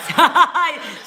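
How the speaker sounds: burst of laughter. Real, not acted. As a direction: laugh out loud at the joke. Laughs at the glass building joke.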